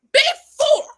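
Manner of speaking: "Before" high, loud and drawn out; stress on Before. Real, not acted. Vlogger repeating mom's advice to viewers, stressed. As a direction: hammer home "Before".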